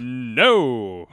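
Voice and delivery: loud, drawn-out no; firm hammed-up refusal; scolding refusal. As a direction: stretch out a loud, disapproving no. Watson refuses Holmes's demand.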